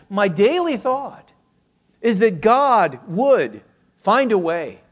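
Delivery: preaching with mounting fervour; fervent preaching. Acted. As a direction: build it up like a fervent sermon. Preacher builds to a passionate climax.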